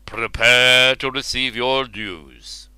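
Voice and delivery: slow, grand royal voice; royal king voice. Acted. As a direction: speak slowly like a king. Ubar speaks.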